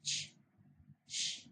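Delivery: "ch, sh" as bare voiceless sounds, not letter names; isolated phoneme demo. Shown as a demonstration. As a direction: say "ch, sh" as two short hushed consonants with a pause between. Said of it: "letter can represent different phonemes".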